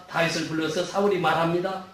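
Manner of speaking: usual speaking voice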